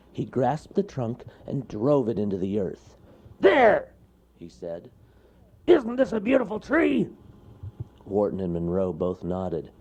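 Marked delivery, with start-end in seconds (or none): 3.4-7.1 high, loud proud bear; loud cartoon bear boasting; boastful bear. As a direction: show off the tree. Bear shows off tree.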